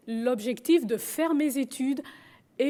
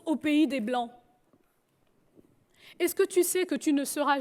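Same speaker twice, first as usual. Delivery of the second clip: pleading father; 4 semitones higher, 3 dB louder than usual. Retold or imitated. Father urges daughter to return.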